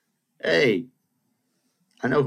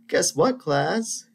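teasing teacher announcement; 12 semitones higher, 4 dB louder, much slower than usual. Retold or imitated. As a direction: imitate a teacher's high, lilting question.

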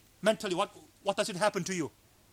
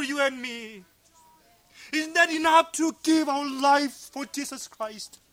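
loud, raised preacher's voice; impassioned preaching; 5 semitones higher, 8 dB louder than usual. Real, not acted. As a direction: preach it out with full force. Preaches at full force.

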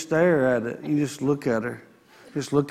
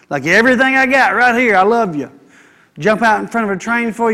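raised, louder vow of all-out love; passionate declaration; 5 semitones higher, 6 dB louder than usual. Retold or imitated. Pastor acting out his vow to his wife, fervent and loud. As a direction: pour everything into it, high and loud.

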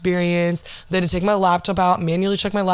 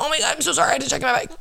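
high-pitched mock apology; mocking imitation; 7 semitones higher, much faster than usual. Line cutters making excuses about checking a bag, mockingly imitated. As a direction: imitate a fake, over-sorry excuse up high.

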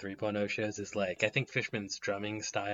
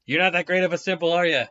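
loud, high, fast interrogator; bad cop voice; 7 semitones higher, 8 dB louder, faster than usual. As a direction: grill him like a tough cop. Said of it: "I wanna be the bad cop".

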